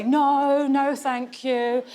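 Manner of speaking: drawn-out polite no, thank you; slow firm refusal. Retold or imitated. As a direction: stretch the no, thank you slowly and firmly. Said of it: "And I'll still say".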